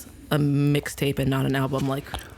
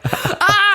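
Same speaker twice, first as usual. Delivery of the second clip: high, loud mock scream through laughter; laughing imitation of screaming alone; retold scream; 6 dB louder than usual. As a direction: re-enact a delighted scream. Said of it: "I literally screamed".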